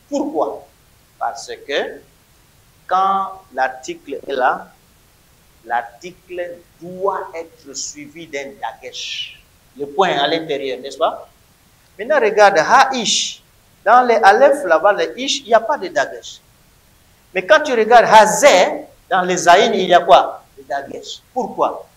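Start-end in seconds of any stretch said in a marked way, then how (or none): none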